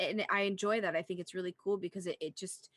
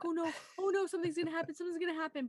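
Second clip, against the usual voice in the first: high, anxious "oh no" building; mock worried; 10 semitones higher, much faster than usual. Retelling her fear for the rat, mock worried. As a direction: fret like watching a scary scene. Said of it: "I was like".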